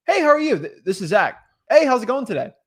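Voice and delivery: loud, high, casual hello; natural greeting. Shown as a demonstration. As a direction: greet them like yourself, upbeat and easy. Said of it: "Use something that's natural for you".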